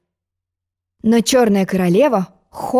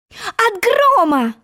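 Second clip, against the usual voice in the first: blurted; 12 semitones higher, much slower than usual. Acted. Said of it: "выпалила Алиса".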